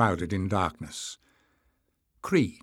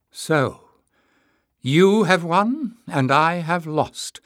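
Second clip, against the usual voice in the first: slow, raised, high-pitched reproach; bitter; 7 semitones higher, 6 dB louder, much slower than usual. A narrator's reading. Tyrrell returning the companions' weapons in defeat, bitter. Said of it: "Tyrrell said bitterly".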